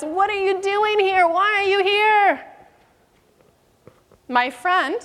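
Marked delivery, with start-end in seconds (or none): 0.0-2.5 higher mock yell at strangers; mock angry yell at door knockers; retold yell. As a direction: yell it like she's chasing them off. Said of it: "she begins to yell at us".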